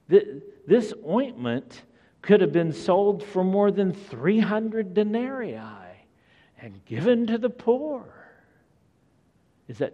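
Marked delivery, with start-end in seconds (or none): 0.1-8.0 rising, higher, grumbling objection; indignant complaint. Acted. Disciples complaining about the wasted ointment, mock indignant. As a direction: huff it out like it's a scandal. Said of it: "said to themselves indignantly".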